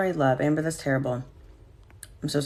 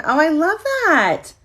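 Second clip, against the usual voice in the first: much higher, slow, gushing oh I love that; delighted; 9 semitones higher, 4 dB louder, slower than usual. Real, not acted. Thrilled by a viewer's suggestion on her live stream, gushing. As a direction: melt with delight at a great idea.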